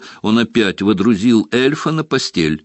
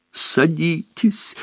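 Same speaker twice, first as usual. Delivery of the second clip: wailing; 10 semitones higher, much slower than usual. Acted. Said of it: "голосил он".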